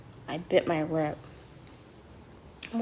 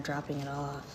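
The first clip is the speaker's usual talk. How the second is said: ordinary talk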